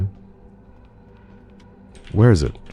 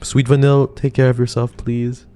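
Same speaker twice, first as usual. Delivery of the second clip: much higher, gentle sing-song goodbye; high tender send-off; sweet farewell; 9 semitones higher than usual. Real, not acted. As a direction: coo a fond goodbye to a viewer.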